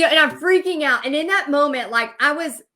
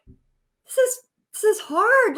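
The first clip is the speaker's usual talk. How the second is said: tearful voice cracking; 8 semitones higher, 3 dB louder, slower than usual. Retold or imitated. Second drink, lamenting failure.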